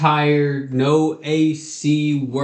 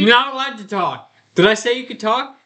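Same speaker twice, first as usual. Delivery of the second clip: harsh barking commands; 9 semitones higher than usual. Acted. Mock parent scolding friend.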